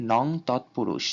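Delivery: নং তৎপুরুষ, with নং ending in a ng sound, said slowly. Shown as a demonstration. Teacher to students, demonstrating নং as ng, slowly. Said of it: "উম এর মতো উচ্চারিত হয়".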